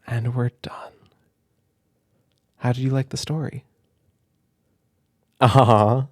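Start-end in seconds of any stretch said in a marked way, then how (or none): 0.1-0.9 soft whisper; whispered ending. Real, not acted. Parent ending the story to the child, whispered.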